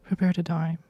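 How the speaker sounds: warm femme sultry read. Acted. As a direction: purr it, warm and seductive. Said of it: "You have a warm, femme, sultry voice".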